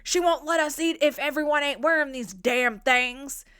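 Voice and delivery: loud put-on gruff voice; gruff quote. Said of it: "he says".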